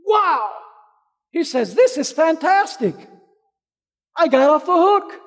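loud, high and thrilled, with a rising Wow; the debtor bursts out, overjoyed at being let off; excited. Retold or imitated. Forgiven debtor to the preacher's listeners, elated exclamation.

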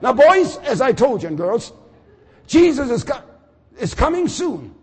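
put-on loud, high preacher's call to his children; father preaching. Risen father calling his children, loud and high. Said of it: "he's gonna pick up a level off".

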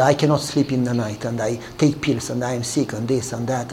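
low, soft retold lament; subdued confiding. Neighbor telling her life story, subdued confiding.

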